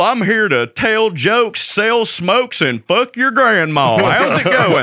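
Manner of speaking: put-on big booming Southern good-ol'-boy. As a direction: boom it out like a big old dude. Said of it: "got a big old booming voice".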